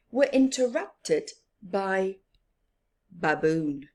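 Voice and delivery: ordinary delivery, nothing marked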